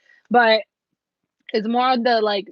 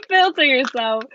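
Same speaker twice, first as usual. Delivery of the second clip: laughing; 6 semitones higher, 7 dB louder than usual. Real, not acted. Reader laughs, telling the querent to filter themselves. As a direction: repeat "Filter yourself" through a laugh, louder.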